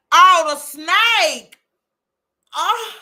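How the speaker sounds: high, loud and slow gasp at the snake; startled. Real, not acted. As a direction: recoil at the sight of it. Sees snake on screen.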